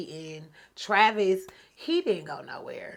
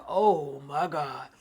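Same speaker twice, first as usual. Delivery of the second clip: retold dread; 4 semitones lower, much slower than usual. Acted dread at uncomfortable moment.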